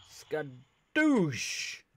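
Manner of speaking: loud, high, drawn-out battle cry; playful, exploding exclamation; attack catchphrase. Pretend. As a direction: shout it like a finishing blow. Catchphrase shouted on a finishing attack.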